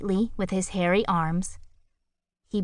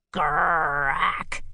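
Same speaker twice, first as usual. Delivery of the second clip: growled; 2 semitones lower than usual. A narrator's reading. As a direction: growl it from the belly. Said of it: "he growled".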